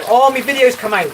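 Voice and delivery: high, loud burst of excitement; excited outburst. Real, not acted. Streamer noticing their video is out, excited outburst.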